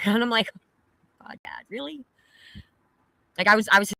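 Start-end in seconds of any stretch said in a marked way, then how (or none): 1.2-2.0 exasperated whisper. Retold or imitated. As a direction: breathe it out in disbelief. Exasperated retort in retold call.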